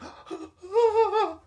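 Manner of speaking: high thrilled gasp; a big gasp of disbelief; sharp excited gasp. Retold or imitated. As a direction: gasp in delight at the email. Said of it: "And I'm like".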